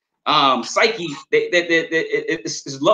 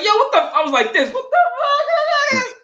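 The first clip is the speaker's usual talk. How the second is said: much higher, mock-stunned yelp; high, disbelieving mock yell; shocked outburst. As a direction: act floored at how big he got. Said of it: "I said".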